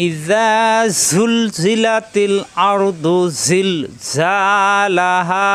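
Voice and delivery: slow melodic recitation of the verse; Quran recitation. Shown as a demonstration. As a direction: stretch it out like a reciter.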